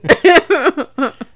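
loud laugh burst. Real, not acted. Still laughing.

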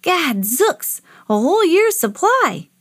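put-on high, excited boyish exclamation; amazed delight. A narrator's reading. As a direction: marvel at the huge haul. Boy amazed by thousand pies.

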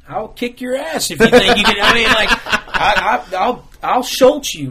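loud, laughing mock threat. Real, not acted. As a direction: threaten him while cracking up.

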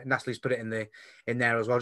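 ordinary talk, nothing marked

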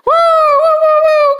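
loud high woo cheers; cheering. Acted. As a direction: whoop like at a party.

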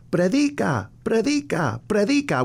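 high, echoing heavenly call; voice from the cloud. Retold or imitated. Mother's dream voice from the cloud commanding him to preach, high heavenly call. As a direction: call it down from above like a vision. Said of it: "from the cloud".

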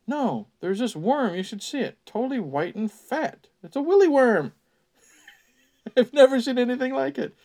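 excited squeal. Acted. Albert squealing to the campers about a worm.

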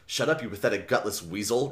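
ordinary talk, nothing marked

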